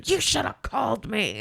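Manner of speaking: slow, imitated angry caller; hammed-up irate complaint; mock yell. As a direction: mimic a guest's drawn-out accusation. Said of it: "he just continues to yell".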